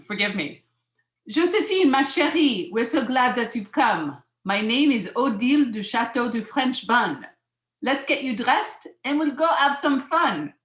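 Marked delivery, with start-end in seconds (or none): 1.3-10.5 high, loud, hammed-up French accent; gushing French hostess with a fake French accent; bad French accent. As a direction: welcome a child in an exaggerated French accent. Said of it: "I'm gonna do my bad French accent".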